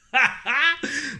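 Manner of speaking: high-pitched burst of laughter. Real, not acted. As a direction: crack up briefly, high. Laughs at the message. (éclat de rire aigu)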